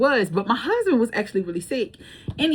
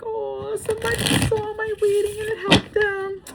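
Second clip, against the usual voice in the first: light, pleased sing-song; delighted; 13 semitones higher than usual. Retold or imitated. Reacting to viewer comments, high delighted inner voice.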